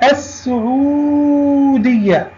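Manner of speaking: السعودية drawn out, silent lam and doubled s; slow, high demo of السعودية with a long u; sun lam. Shown as a demonstration. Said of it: "لام شمسية". (نطق «السعودية» ببطء بلام صامتة وسين مشددة)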